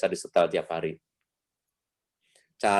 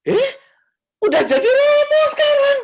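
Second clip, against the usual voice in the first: very high, loud squeal of greeting; same yelling voice; 9 dB louder than usual. Retold or imitated. Neighbour's mother greeting the priest, shrieking high and loud. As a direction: shriek the greeting like she drilled her son. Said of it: "Ngomongnya ternyata sama loh".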